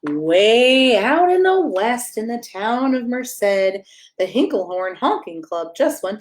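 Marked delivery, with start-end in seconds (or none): 0.1-2.2 drawn out. Acted. Dramatic slow story opening.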